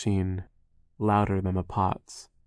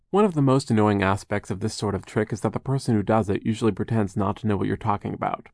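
ordinary delivery

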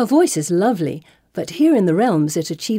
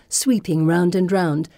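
ordinary delivery, nothing marked

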